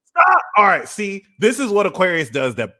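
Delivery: high, loud and worked up; excited outburst. Real, not acted. Ranting at Aquarius over the clip. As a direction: blurt it out.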